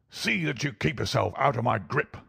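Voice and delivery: put-on snarling old villain's threat; snarled. A narrator's reading. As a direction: snarl it as a parting warning. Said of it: "he snarled".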